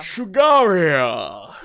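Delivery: drawn-out, loud, swooping place name; hammed-up slow fanfare delivery; grand theatrical announcement. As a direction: proclaim the kingdom with a flourish.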